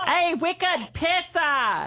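high, drawn-out mock Boston accent; badly faked Boston accent, stretched out slowly; holler-pitched, hammed-up Bostonian; attempted Boston accent. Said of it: "I'm not good at that".

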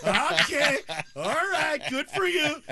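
high, loud, sarcastic yelling dad; dad catching his son's trick, shouting; mock outraged shout. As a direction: yell it in fake indignation, laughing. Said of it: "And I'm like".

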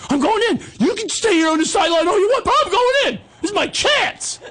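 high falsetto defiance; insistent squeaky boy. Retold or imitated. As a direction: argue back in a piping, determined voice. Boy self insists on playing.

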